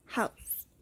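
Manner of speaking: "house" with a breathed English h at the start; English aspirated H. Shown as a demonstration. Teacher modelling rough breathing for students, aspirated H. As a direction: say "house", opening on an audible puff of h. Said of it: "como la H en la palabra inglesa".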